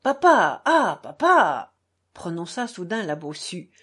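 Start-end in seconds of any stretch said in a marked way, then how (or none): 0.0-1.7 loud, stretched cry to father; high, anguished exclamation; distressed outcry. A narrator's reading. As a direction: cry out suddenly, dragging the second call. Daughter suddenly cries out to father.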